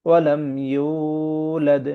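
very slow, drawn-out tajweed chant; Quran recitation. Acted. Teacher reciting the verse for the class, slow chant. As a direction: recite it slowly like a qari.

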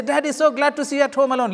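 high, quick happy greeting; eager children. Acted. Children cheerfully greeting their father home early, high and fast. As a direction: bubble over at daddy being home.

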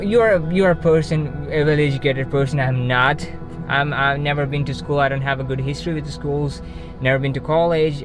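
normal talking voice

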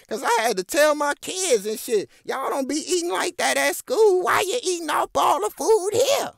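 high, loud mock scolding parent; mocking mom voice. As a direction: ham up her scolding her kids, high. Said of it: "And then she said".

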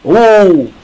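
ordinary delivery

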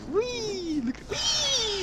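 playful high squeal. Pretend. Player showing off his skins to the guys, playful squeal.

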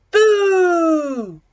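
high, loud, long-held shout; startling shout that slides down at the end; shouted boo. A narrator's reading. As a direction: shout to make everyone jump. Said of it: "shouted Elmer".

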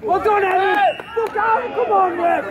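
loud cheering shout; yelling from the sidelines. Real, not acted. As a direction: yell across the pitch at players. Parent yelling at match.

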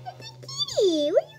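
very high, tiny cooing squeal; gushing squeal. Real, not acted. Player gushing at a kitty, very high squeal. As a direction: coo way up high at a pet.

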